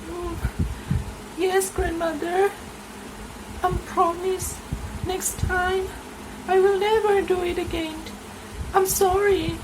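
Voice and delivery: put-on very high, apologetic child's voice; a small girl promising to behave; contrite little girl.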